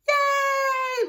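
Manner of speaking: high shouted cheer; excited cheer. Retold or imitated. Family cheering the baby boy reveal, a high excited shout.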